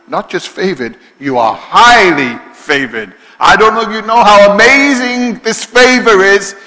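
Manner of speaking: loud, high impassioned preaching; hammered-home angel's message to Mary; preaching at full force. Acted. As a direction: proclaim it with rising fervour.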